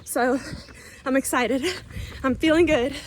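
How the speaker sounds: breathless talk mid-jog; panting between words on a run; while running. Real, not acted. Talking mid-run, measures raised.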